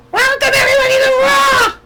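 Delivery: very high, loud mock shriek; shrieking Stephanie. Vlogger mocks Stephanie welcoming the crowd, very high shouted shriek. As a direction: screech it out to make ears bleed. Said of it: "she's gonna make your ears bleed".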